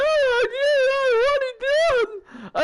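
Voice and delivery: very high, squeaky mock voice; mocking Shaky. Host mimicking Shaky's reply to the stream, squeaky and high. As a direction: send up a friend in a silly high voice. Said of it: "And Shaky was like".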